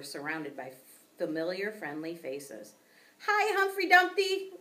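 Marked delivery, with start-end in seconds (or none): 3.3-4.5 high, loud cheery hello; excited greeting. A narrator's reading. Old classmate greeting the class hamster, high and loud. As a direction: greet him brightly.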